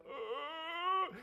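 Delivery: high, drawn-out wordless noise of realization; acted dawning-recognition sound; stunned rising groan. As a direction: let out a long, climbing gasp-moan. Acts Peter's stunned reaction.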